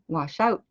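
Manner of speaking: crisp final T in "out"; sharp final sound. Shown as a demonstration. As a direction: finish "out" on a carefully released T. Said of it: "there's a sharpness".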